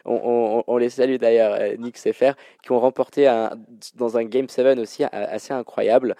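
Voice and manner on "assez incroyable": usual speaking voice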